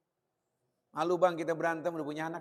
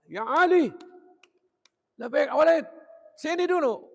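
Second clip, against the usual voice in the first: loud, high father-and-son hailing; acted calling out; 9 semitones higher, 9 dB louder than usual. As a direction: hail your boy from far away. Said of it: "ini dia kayak begini nih".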